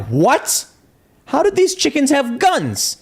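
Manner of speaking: high, slow, incredulous squeak; mock disbelief. Farmer facing armed roosters, high slow disbelief. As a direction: act stunned that chickens are armed. Imagined reaction to armed chickens.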